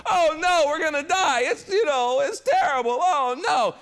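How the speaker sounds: high, loud mock panic; hammed-up wail of doom from frightened disciples; freaking out. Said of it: "the disciples freak out".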